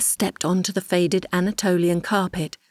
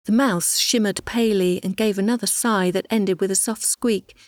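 plain reading voice

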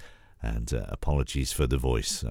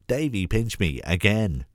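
repeating complaint; 7 semitones higher than usual. A narrator's reading. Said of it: "Sophie reiterates".

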